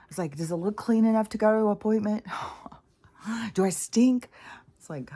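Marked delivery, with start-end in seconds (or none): 0.4-4.3 anxious fretting. Retold or imitated. Anxious self-questioning quote.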